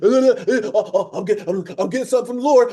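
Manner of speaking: loud, stammering mock trance; theatrical church seer. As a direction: fake a spirit taking hold, hammed-up. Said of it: "all this fancy stuff even in church".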